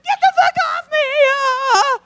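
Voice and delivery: shrill, loud, high screech; mock scream. Woman dragged out of store screams at staff, high and loud. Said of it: "She's like".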